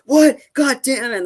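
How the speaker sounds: sudden high yelp of frustration, then a curse; angry outburst. Retold or imitated. Moses losing it when the hack happens, angry outburst. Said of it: "he's like".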